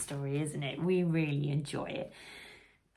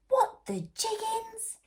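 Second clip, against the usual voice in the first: much higher, squeaky astonishment; shocked gasp. Acted. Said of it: "gasped all the little bees".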